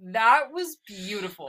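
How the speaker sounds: slow, teary voice breaking; real tearful slow praise; crying. Real, not acted. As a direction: choke out the words through tears.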